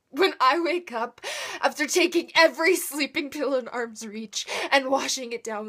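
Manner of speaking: loud, pained outpouring; anguished outburst. Acted. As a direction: let the anguish burst out loudly. Anguished monologue about failed attempts.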